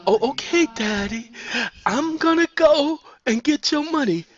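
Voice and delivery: high, fast, frightened begging; frightened pleading. Acted. Sandra backs away from Henrik, scared and pleading. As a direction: stammer out a scared promise.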